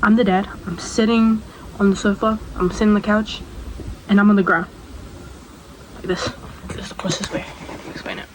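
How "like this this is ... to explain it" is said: fast, much softer, breathy under-the-breath aside; quick whispered side note to viewers. Real, not acted. Aside while acting out scene.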